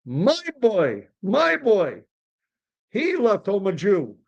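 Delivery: high, wailing oy; lamenting father. Acted. Father matching friend's woe about his son, lamenting. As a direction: lament with big swooping pitch. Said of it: "Mi-, Mister Cohen says".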